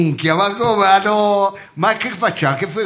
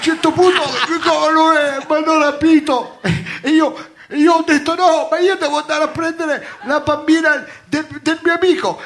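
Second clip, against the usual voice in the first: excited; 9 semitones higher, 3 dB louder than usual. Acted. Tells absurd abduction story.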